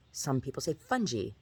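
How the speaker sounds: fungi with a j sound and long e. Shown as a demonstration. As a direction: fungi as FUN-jee.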